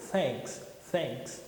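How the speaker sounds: "Thanks" said softly, with air blown through the teeth; a quiet, breathy "thanks" with the tongue between the teeth. Shown as a demonstration. Teacher repeats the blown th softly. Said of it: "Você escuta o sopro".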